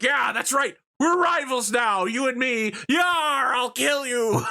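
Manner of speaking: high, shouty hammed-up villain; high mock maniac shout; Malavai Fletcher. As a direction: bellow the threat like a pirate madman. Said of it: "He's like".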